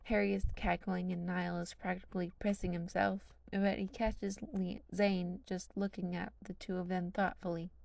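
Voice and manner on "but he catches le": usual speaking voice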